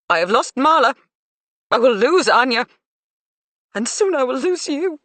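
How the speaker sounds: put-on mother's voice breaking as she fears losing her children; mother fearing she'll lose her son too, voice cracking. A narrator's reading. High, loud lament breaking at the end. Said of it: "Her voice cracked".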